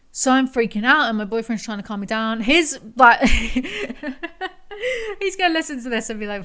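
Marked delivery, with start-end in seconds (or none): 3.3-5.1 real burst of laughter. Real, not acted. Laughing at boyfriend's reaction.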